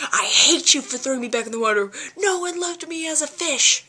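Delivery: hammed-up sobbing fury of a spurned fish; mock angry Gyarados. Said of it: "it's like".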